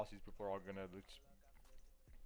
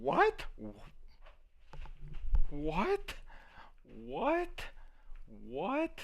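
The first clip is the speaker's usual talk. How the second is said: surprised what; 5 dB quieter, much slower than usual. Real, not acted. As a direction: ask in disbelief. Disbelief at the price move.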